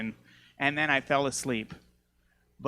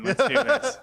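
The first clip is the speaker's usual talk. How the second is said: hyped dick; 9 semitones higher, 5 dB louder than usual. Retold or imitated. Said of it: "He was like".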